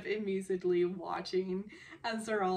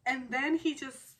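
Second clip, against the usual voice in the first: normal talking voice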